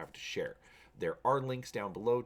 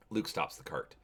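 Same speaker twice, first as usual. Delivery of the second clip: normal talking voice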